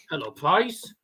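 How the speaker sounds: ordinary talk, nothing marked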